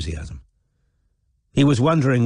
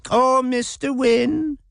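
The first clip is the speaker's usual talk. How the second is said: much higher, slow, silly mocking imitation of a simpering girl; imbecilic mock; much slower than usual.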